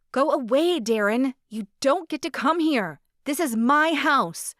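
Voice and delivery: high, loud frightened yell. Acted. Woman yelling at her drunk ex through the door. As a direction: scream him away from your door. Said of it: "I heard her yelling".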